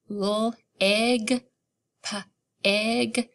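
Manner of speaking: L, egg, p, egg split into onset and rime; sounding out. Shown as a demonstration. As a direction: segment L and p away from egg. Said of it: "what sound do you hear in the middle".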